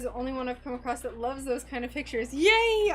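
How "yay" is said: high, loud burst of joy; excited yay. Real, not acted. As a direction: cheer it out happily. Excited reaction to nature pictures.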